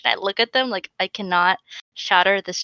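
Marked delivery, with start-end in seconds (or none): none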